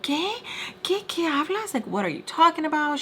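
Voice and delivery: high-pitched mock bafflement; retold bewildered protest. Mister Peach woken to an accusation, mock bewildered protest. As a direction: imitate his confused comeback, pitch up.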